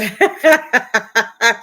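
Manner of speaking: big laugh bursting out; loud laugh. Real, not acted. Laughing at the in-laws and Roomba story, loud laugh.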